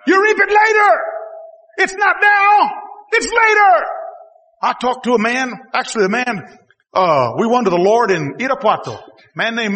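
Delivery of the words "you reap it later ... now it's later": high, loud emphatic shout; preaching shout. Real, not acted. Preacher warning the congregation, shouted emphasis. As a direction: drive home the point with full force.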